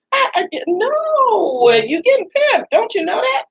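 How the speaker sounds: very high, near-crying squeal; squealing outburst. Retold or imitated. Speaker telling them they're getting pimped, in a very high mock-crying squeal.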